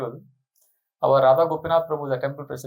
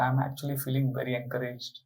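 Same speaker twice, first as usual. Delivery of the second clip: softer, lower, humbly touched; humble and moved; 5 semitones lower, 3 dB quieter than usual. Retold or imitated. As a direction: say it softly with head bowed. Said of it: "humbly put his head down and said".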